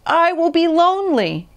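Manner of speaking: high, plaintive wail; high-pitched desolate call; storybook shout. A narrator's reading. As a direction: cry it out high, pleading for company. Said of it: "he shouted".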